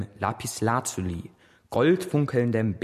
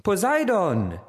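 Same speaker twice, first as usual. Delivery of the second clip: überrascht rufen; 10 semitones higher, 3 dB louder, slower than usual. Acted. Hermes ruft Poseidon erstaunt.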